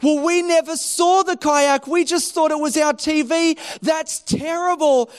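high-pitched pretend sympathy; mock concern, playing innocent; feigned upset. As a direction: act upset to fool a friend. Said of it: "trying to act like they were so upset".

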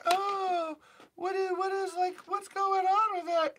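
high, mock-puzzled voice; mocking confused viewer. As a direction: play the clueless viewer.

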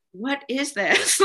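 slow, puzzled disbelief; baffled. Retold or imitated. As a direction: say it like you can't believe it. Baffled by caring messages.

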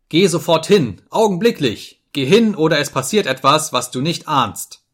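high, urgent command turning to threat; angry order. A narrator's reading. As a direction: demand instant obedience in a temper. Enraged order repeated after servant laughs.

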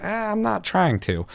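dismissive. Retold or imitated. Dismissive reply to a commenter.

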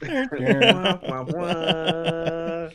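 high, loud wah-wah sting; sad trombone imitation. Friend caps the sitcom gag, mock trombone wahs. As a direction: sitcom fail sound sung through laughter.

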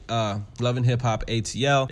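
usual speaking voice

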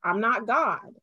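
ordinary talk, nothing marked

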